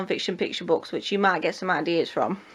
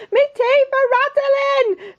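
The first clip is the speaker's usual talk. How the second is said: very high, drawn-out wail; scared cook; 6 dB louder, much slower than usual. Acted. Cook afraid of fishing for the king, high panicky wail. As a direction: squeal it like a terrified storybook wimp.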